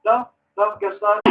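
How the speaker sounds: ordinary talk, nothing marked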